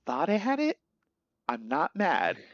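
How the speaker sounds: imitating a let-down dad, not mad; lightly voiced disappointed parent; mock disappointment, as to a kid. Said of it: "like a dad talking to his kid".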